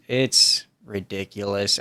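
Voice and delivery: ordinary delivery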